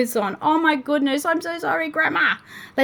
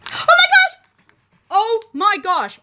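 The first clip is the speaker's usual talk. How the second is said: sudden high, loud shriek of delight; excited shriek; 8 semitones higher, 8 dB louder than usual. Real, not acted. Opening the kettle pack, thrilled shriek. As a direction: shriek at the surprise.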